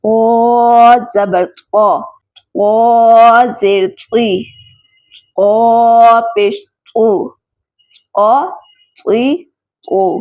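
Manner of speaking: drawn-out letter-by-letter recitation drill; slow spelling drill. Shown as a demonstration. Teacher drilling students on letters, slow spelling drill. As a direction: spell each syllable slowly and deliberately, like a patient tajweed teacher.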